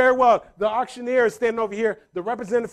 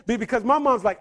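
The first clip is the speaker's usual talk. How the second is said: usual speaking voice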